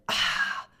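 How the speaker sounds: voiceless breathy exhale; whispered "ah" sigh; exasperated sigh. Real, not acted. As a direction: let out a big breath. Exhales after tense retelling.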